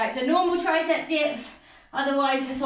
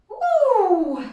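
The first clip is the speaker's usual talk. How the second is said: excited whoop; 6 semitones higher, 6 dB louder than usual. Real, not acted. Cheering mid workout.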